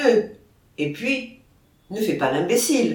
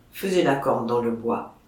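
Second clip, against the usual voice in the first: ordinary delivery, nothing marked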